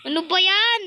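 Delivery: high, loud exasperated question; exasperated outburst. Real, not acted. Gamer reacting to another failure in Roblox, exasperated. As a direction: burst out at the game, pitch climbing.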